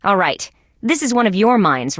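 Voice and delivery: usual speaking voice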